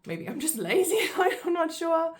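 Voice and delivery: high, loud, laughing at herself. Real, not acted. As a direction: laugh it off, self-mocking.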